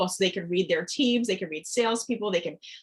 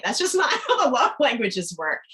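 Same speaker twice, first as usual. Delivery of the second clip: laughing through the words, a bit louder; 5 semitones higher, 5 dB louder than usual. Retold or imitated. Coach laughingly corrects clients about love languages. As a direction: chuckle your way through the correction.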